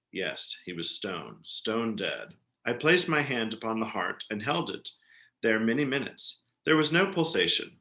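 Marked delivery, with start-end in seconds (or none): 0.1-2.3 hushed gloating. A narrator's reading. Gloating whisper over corpse.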